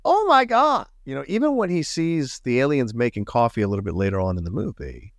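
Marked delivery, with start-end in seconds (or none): 0.0-0.9 high, loud shocked exclamation; overreacting in shock; mock surprised gasp. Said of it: "is never like".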